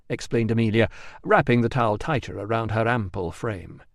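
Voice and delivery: ordinary delivery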